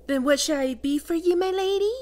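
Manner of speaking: high, fast mocking simper; mocking imitation. Succubus mimicking her pet's question, high mocking voice. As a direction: parrot the pet in a high mocking voice.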